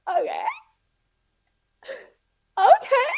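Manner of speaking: high-pitched, strained okay; squeaky mock okay. Stylist shows silver, she squeaks a defeated okay. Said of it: "And then I'm like".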